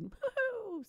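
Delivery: high whooping cheer; excited cheer. Real, not acted. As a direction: whoop it up for her. Cheering for a friend's wedding.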